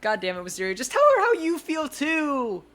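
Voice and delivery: loud shout climbing to a high squeal; exasperated shout. Real, not acted. Reader fed up with mind games, exasperated shout. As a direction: yell at them, pitch soaring.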